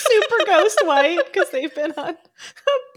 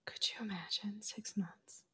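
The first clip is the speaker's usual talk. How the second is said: whispering; 7 semitones lower, 10 dB quieter than usual. Real, not acted. Stunned aside.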